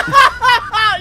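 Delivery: loud high burst of laughter; big laugh. Real, not acted. Host cracks up at his own cold open joke, loud laugh. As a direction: cracks up hard.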